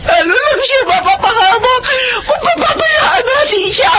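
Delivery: high, crying protest; a desperate mother pleading with her brother; tearful plea. Acted. Mother fears for son.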